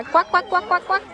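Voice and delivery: high, loud playful quacking; duck quacks. Pretend. Mom quacking loudly at ducks for the toddler. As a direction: quack back at the ducks to amuse the child.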